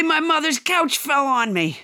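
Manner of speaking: ordinary talk, nothing marked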